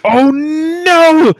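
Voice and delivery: high, long wailed "oh no"; big high-pitched dismayed yell; loud cry of dismay. Real, not acted. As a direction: cry out loudly, stretching the "no". Mock dismay interrupting film discussion.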